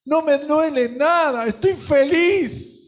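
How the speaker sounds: a hint of sobbing through joy; tearful joy. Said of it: "seguía ahogado con llanto". (alegría entre lágrimas, más aguda y lenta)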